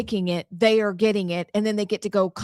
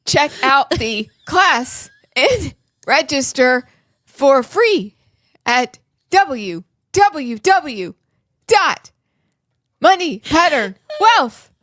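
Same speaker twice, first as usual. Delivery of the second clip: slow, loud announcer read; 4 semitones higher, 6 dB louder, slower than usual. Acted. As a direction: spell out the address like a commercial. Slow, loud web address read.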